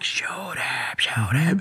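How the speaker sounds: voiceless whisper; mouthing. Retold or imitated. Mouthing to the other student to shoot. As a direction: mouth the words with barely any sound. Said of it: "I'm mouthing it".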